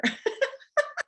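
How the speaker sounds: high squealing laugh; delighted laugh. Real, not acted. Butterfly landing on her head, squealing laugh.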